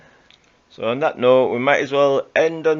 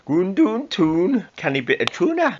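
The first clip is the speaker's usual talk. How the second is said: mock Geordie accent; 7 semitones higher than usual. Host fumbling a Geordie impression for laughs. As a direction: bluff through some Geordie words. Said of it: "I can't do a Geordie accent".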